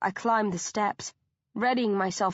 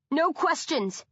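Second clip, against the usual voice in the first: sharp raised command; shouting; 7 semitones higher, slower than usual. A narrator's reading. Bea shouts at Anya while fleeing, urgent shout. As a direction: cut her off with a shout. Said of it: "I shout".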